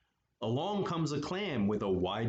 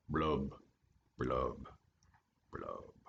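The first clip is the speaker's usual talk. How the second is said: blub blub blub, dropping lower and softer to nothing; sad fading blubs; 10 semitones lower, 5 dB quieter, slower than usual. Acted. Gloomy fish's blubs fading out after his refrain. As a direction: let each blub sink and die away.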